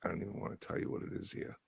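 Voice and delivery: hushed whisper; whispered brag about the good weather. Real, not acted. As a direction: whisper it so the others can't hear.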